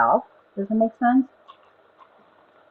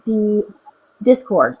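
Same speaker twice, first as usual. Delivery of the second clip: muffled, like underwater in a cave; talking through a bad earbud mic; 4 semitones higher, slower than usual. Real, not acted. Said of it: "you sound all muffled".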